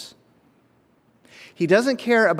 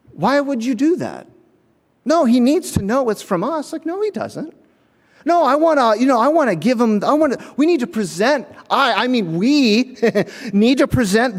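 higher, insistent mock whine; whiny entitled student; 7 semitones higher than usual. Freshman whining to the dorm about the fundraiser, high and insistent. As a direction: plead like a self-important freshman. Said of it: "And he was like".

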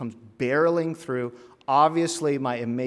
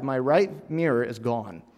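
usual speaking voice

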